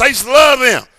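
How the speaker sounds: ordinary delivery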